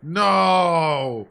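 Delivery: loud, panicked cry of no; alarmed shout. Real, not acted. Streamer yelling at the game in alarm. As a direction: shout it in real dread. Dreading Undyne chasing him.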